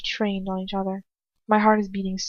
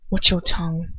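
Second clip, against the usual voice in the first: ordinary delivery, nothing marked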